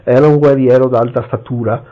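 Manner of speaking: plain reading voice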